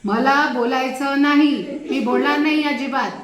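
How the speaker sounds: mean the refusal, full force; loud, cutting angry refusal; real anger. Shown as a demonstration. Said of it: "राग आहे।".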